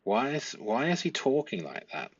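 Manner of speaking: normal talking voice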